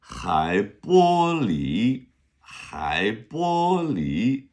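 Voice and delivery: Hai bo li said very slowly, syllable by syllable; slow model reading. Shown as a demonstration. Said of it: "is how we're saying sea glass".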